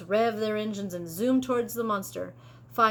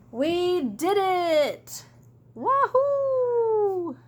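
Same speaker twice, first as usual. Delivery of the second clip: slow, high joyful whoop; victory cheer; 9 semitones higher, 4 dB louder, much slower than usual. Acted. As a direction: celebrate the win out loud. Victory cheer after winning.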